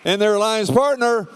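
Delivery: high, hyped-up arena announcer. Acted. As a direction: pump up the crowd announcing teams. Hypes the match line-up.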